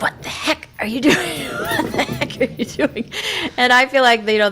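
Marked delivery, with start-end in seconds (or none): 0.0-3.1 put-on whispered, then laughing old dog; high, breathy puzzled dog voice; bemused old lab. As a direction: wonder aloud at the frantic terrier. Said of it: "he's sort of like".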